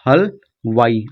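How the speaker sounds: हलवाई slowly split as हल, then वाई; teacher breaking हलवाई apart for beginners; broken into parts. Shown as a demonstration. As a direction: sound out हलवाई in two slow chunks. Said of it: "आप ऐसे बना दीजिए".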